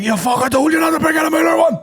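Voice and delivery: high furious mock shout; angry teacher shout. Teacher yelling at the pupil who brought a Mueller, mock furious shout. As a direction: bellow like a raging teacher. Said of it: "He was like".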